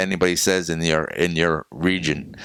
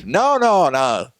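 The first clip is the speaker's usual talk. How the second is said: high, louder "no, no, no, Pecan" to correct him; mother correcting the clerk, insistent and louder; insistent correction; 12 semitones higher, 4 dB louder, slower than usual. Retold or imitated. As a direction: insist on the right word, raising the voice.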